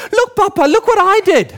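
put-on very high, proud child voice; little boy. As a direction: squeal it like a toddler showing off. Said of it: "It's like a little boy".

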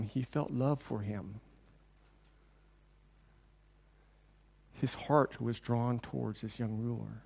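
low, slow and soft; tender. Real, not acted. Preacher softening as Jesus feels love for the ruler. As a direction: let the love in it show.

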